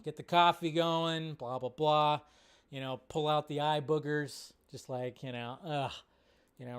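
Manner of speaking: ordinary talk, nothing marked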